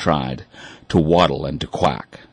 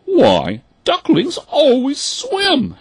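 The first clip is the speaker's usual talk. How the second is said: high, slow, shocked mother duck; a mother duck aghast at her child; gasping mother duck; 4 dB louder, slower than usual. Acted. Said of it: "she gasped".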